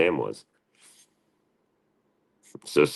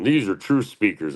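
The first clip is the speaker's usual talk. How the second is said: mocking quote; 6 semitones higher than usual. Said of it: "so tired of people going".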